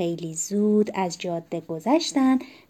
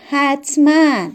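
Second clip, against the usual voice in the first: loud, higher, drawn-out reply; calling back; 6 semitones higher, 8 dB louder, slower than usual. A narrator's reading. Mother answering father's parting shout, calling out. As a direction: stretch it out and call it back.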